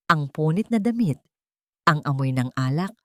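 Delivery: plain reading voice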